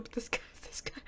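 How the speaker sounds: soft unvoiced whisper; hushed, stunned whisper. Real, not acted. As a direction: whisper it under your breath. Hushed reaction to creepy line.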